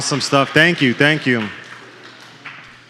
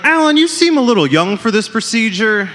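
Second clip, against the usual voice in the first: doctor's quote; 7 semitones higher than usual. Retold or imitated. Doctor voiced in stand-up bit.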